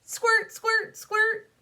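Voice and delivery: high, loud squirt call; squirt sound effect. Acted. Clown girl playing seltzer at her mirror, high squeaky squirts. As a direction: squeal the word like a squirt bottle. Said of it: "pretended to squirt myself with seltzer".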